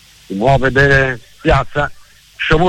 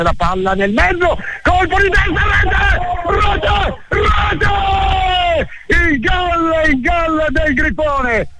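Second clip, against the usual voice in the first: breathless italian goal commentary; 10 semitones higher, much slower than usual. Real, not acted. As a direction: erupt as the header goes in. Goal scored, commentator erupts.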